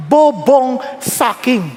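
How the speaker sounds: normal talking voice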